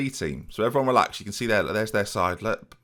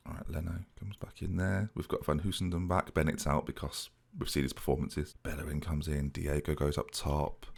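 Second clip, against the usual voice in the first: hushed, much softer team-sheet read; whispering; 8 semitones lower, 11 dB quieter than usual. Real, not acted. Manager picking the team to viewers, whispered softly. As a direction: whisper the line-up under your breath. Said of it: "I don't know why I'm whispering".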